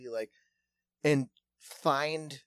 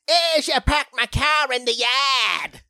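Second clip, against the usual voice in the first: higher, louder hammed-up Midwest twang; mock Dakota accent; 9 semitones higher, 8 dB louder than usual. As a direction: ham up a rural South Dakota yokel. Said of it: "someone from Yankton, South Dakota going".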